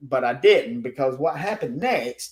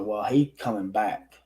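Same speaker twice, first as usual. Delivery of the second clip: usual speaking voice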